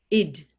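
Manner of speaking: Id said alone as a short clear syllable; isolated sound for a phonics class; ending sound id. Shown as a demonstration. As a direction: model the ending Id for pupils to copy. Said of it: "It's pronounced id".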